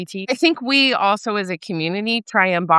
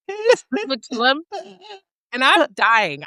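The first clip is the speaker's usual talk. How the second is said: ordinary talk, nothing marked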